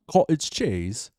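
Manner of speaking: slow, hesitant put-on British accent; British accent attempt. Shown as a demonstration. Trying a British accent on request, hesitant British. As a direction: try out British vowels, clumsily. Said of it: "Can you do a British accent?".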